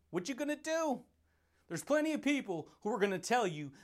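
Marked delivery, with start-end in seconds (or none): 0.1-1.0 high mock taunt, softer. Real, not acted. Taunting the viewer who gets mad, high and teasing. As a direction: tease the listener with a lifted voice. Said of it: "taunt repeated higher".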